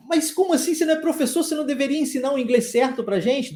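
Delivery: high, quick mock-indignant student; protesting student. Voices a protesting student, then answers.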